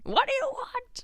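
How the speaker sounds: very high-pitched mock demand; retold exasperation. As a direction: squeal the question in mock exasperation. Voicing the Grinch's exasperated question.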